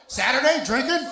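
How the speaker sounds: high, loud shout to the crowd; rousing call-out. Real, not acted. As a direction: holler it high to rally the room. Calling out to the crowd.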